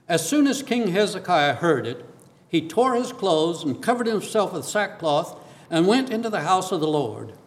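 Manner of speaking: usual speaking voice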